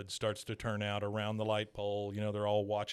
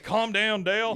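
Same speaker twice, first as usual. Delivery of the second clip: mock Hank calming; 8 semitones higher, 6 dB louder than usual. Retelling Hank holding Dale back, mock calming. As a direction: play Hank, soothing but loud. Hank's line retold.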